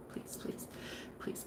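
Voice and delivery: barely voiced pleading whisper; whispered mock begging. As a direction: beg in a tiny whisper. Retold begging insurance.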